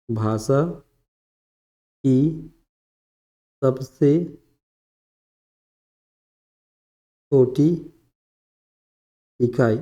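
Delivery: word by word with long pauses; dictation. Real, not acted. Teacher dictating definition of varna, slow dictation. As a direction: dictate slowly, leaving time to write.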